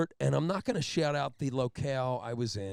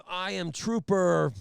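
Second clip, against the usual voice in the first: pompous trooper; 6 semitones higher, 6 dB louder, slower than usual. Retold or imitated. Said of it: "starts it off with".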